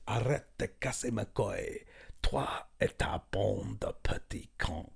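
whispered French threat; menacing guard. Retold or imitated. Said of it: "and I'll never forget it".

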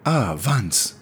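a hint of a delighted old host greeting a friend; Herr Gustavo's voice. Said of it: "Herr Gustavo goes".

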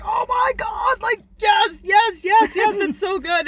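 high, loud excited yells; excited shouting. Retold or imitated. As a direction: shout yes like overjoyed. Retold excited reaction.

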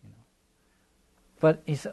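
ordinary talk

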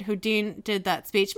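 normal talking voice

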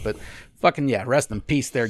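ordinary talk

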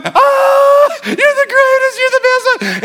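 very high, loud squealing delight; ecstatic dog. Pretend. Pastor voicing a dog thrilled at anyone walking in, squealing high. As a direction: gush like an overjoyed dog greeting its owner. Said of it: "A dog, you walk into the room".

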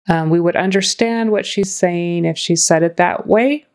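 ordinary delivery

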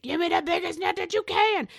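put-on a little girl reeling in a fish calls loudly for the net; imitating a straining little girl. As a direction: strain it out like a kid fighting a big fish. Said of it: "this little girl saying".

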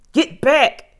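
high, loud urgent warning; shouted warning. A narrator's reading. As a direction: shout them back from danger. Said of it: "shouted Jack at her".

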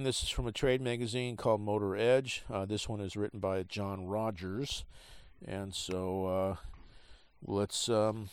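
ordinary talk, nothing marked

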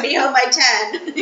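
slow high mock parent warning; mock strict parents. Host teases guest about parents' curfew, mock parent voice. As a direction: drag it out like a strict parent, laughing. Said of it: "Your parents like".

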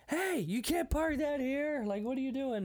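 indignant calling out. Retold or imitated. Archaeologist protesting at the chopper landing, indignant mock yell.

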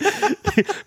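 very high squealing laugh; shrieking laugh. Real, not acted. Host cracking up at his co-host's Lincoln joke, squealing. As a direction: burst into a shrill squeal of laughter.

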